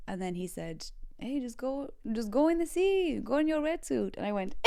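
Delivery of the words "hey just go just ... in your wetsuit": surf teacher's reply. Retold or imitated.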